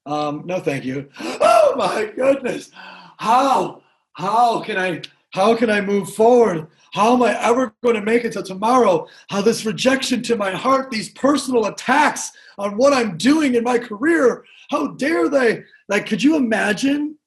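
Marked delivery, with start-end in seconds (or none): 1.1-1.7 sharp high gasp, hammed-up; mock shocked gasp. Waiter shocked by rejection. 1.7-15.6 a lightly hammed-up wounded waiter; mock devastated waiter.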